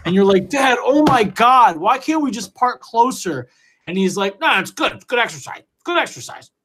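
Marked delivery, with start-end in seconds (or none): 0.5-3.5 put-on high, whining eleven-year-old pleading with dad; whiny kid. 4.4-6.5 high, breezy imitation of a dismissive dad; mock dad. Dad brushing off kid.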